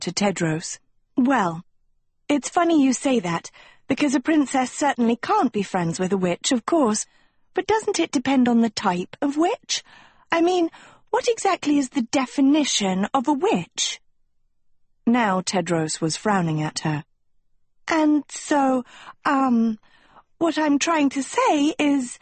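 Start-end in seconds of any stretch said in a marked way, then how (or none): 1.2-14.0 high-pitched, airy hedging; acted sweet stalling; evasive girl. A narrator's reading. Sophie stalls nervously, flattering the prince. 17.9-22.2 put-on high, hesitant girl; acted awkward hedging; stalling. A narrator's reading. Sophie stalls as Tedros frowns.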